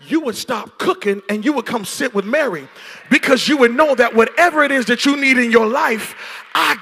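loud, high, shouted preaching over music; preaching at full force. Acted. Preacher exhorting the church, shouting over the organ. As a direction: drive it home like a revival preacher.

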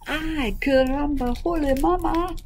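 put-on high-pitched baby chick exclamation. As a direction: squeal it as a newly hatched chick. Baby chick greets its mama.

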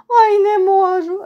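high, loud, drawn-out cry of amusement; acted outburst on the edge of guffawing; exclaiming. A narrator's reading. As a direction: cry out helplessly, stretching the words. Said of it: "раптом вигукнув бурштиновий володар і зареготав".

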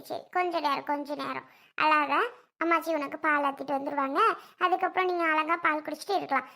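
soft, low hushing talk; gently calm a crying little one; soothing a baby. Acted. Woman promises milk to a crying baby, soothing.

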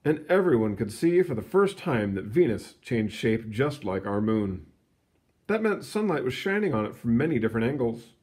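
lively read-aloud storybook voice; story narration. A narrator's reading. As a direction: read it like a picture book to kids.

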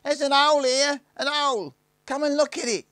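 put-on very high, loud little boy's cry; excited piping. A narrator's reading. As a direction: squeal it with delight. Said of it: "piped up excitedly".

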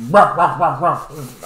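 loud playful barking, ruff ruff; imitating a dog bark. Kid barking at a dog while running, imitating a bark. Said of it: "turned to bark to the dog".

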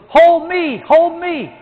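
high, loud retold shriek of hold me; mock nun scream. Priest imitating the nun screaming at him in chapel, hammed-up high yell. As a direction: belt it out like a furious singing nun. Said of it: "This nun screams at me".

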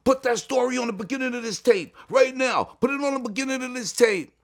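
high, forceful urging. Retold or imitated. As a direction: order it urgently, pitched right up. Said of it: "So [name] was like".

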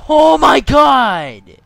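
long, high, very loud wail; shocked scream. Real, not acted. Player hit by sudden shock, drawn-out scream. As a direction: scream it out, stretching the last word.

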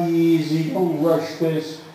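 ordinary talk, nothing marked

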